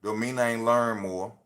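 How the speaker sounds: ordinary delivery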